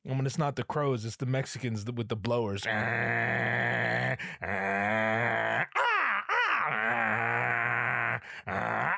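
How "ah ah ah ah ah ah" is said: long, harsh imitation crow caws; crow impression. Podcaster imitating the noisy neighborhood crows, cawing. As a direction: caw on and on like a crow. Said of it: "doing my best impression of a crow".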